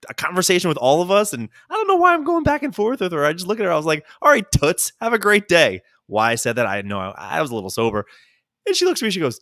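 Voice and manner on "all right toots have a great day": higher and louder, a cheeky brush-off; mock breezy send-off. To the chatty bathroom lady, a mock breezy send-off. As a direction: toss it off like a smug goodbye.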